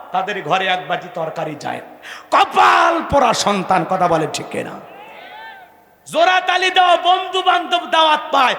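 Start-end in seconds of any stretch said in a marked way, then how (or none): 2.3-8.5 high, loud, fast shouted rebuke; fiery preaching. Acted. Preacher rebukes sons who forget their mothers, fiery shout. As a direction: rail at the ungrateful sons.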